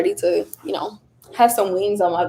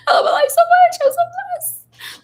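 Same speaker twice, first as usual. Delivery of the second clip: tearful joy. Retold or imitated. Retold shower prayer, tearful joy.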